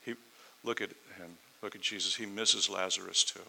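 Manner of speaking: ordinary talk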